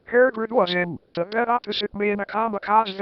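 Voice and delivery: ordinary delivery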